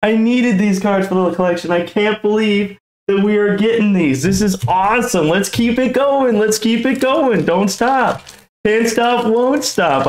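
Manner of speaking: high-pitched, breathless-with-joy excitement; thrilled disbelief. Real, not acted. Collector getting the cards needed for the collection, thrilled. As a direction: keep the pitch up, elated and urging it on. Still thrilled after the pull.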